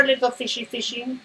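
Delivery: sweet high call to the fish; high coaxing call. Acted. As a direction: coax it in like calling a pet.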